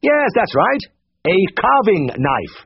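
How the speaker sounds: Siegfried crying out at the slow wife, impatient; cried out. A narrator's reading. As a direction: lose patience with her. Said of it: "Siegfried cried".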